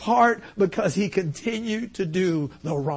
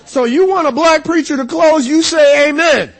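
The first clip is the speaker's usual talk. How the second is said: high, loud call for response; 11 semitones higher, 14 dB louder than usual. Shown as a demonstration. Preacher teaches congregation etiquette, loud exhortation. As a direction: belt it out to draw an amen.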